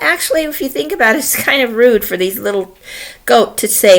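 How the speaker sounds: ordinary delivery, nothing marked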